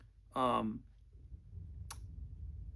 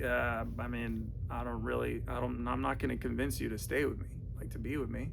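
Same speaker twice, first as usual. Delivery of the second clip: mock calm rational guy; 5 semitones lower, 7 dB quieter than usual.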